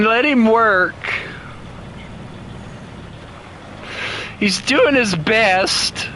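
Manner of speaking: high-pitched, playful pouty protest; mock indignant. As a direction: stick up for your little truck in a pouty high voice. Pouty defence of her little truck.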